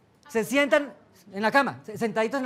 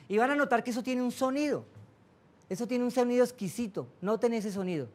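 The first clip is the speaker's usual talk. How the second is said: guided meditation. Acted. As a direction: lead them gently into calm breathing. Guía una meditación. (voz de meditación guiada, suave)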